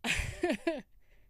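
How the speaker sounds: high bursting giggle. Real, not acted. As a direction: laugh at yourself. Laughs at own voice.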